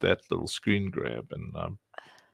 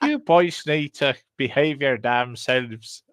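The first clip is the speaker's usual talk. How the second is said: put-on Irish accent; 4 semitones higher, 3 dB louder, slower than usual. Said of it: "She always said".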